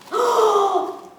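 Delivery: big high-pitched gasp; delighted gasp. Real, not acted. Unboxer sees the gift inside, delighted gasp. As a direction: suck in air at a wonderful surprise.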